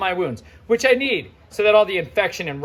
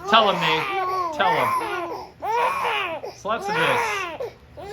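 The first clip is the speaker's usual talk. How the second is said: laughing through the chaos; 12 semitones higher, much slower than usual. Real, not acted. Parent with crying twins.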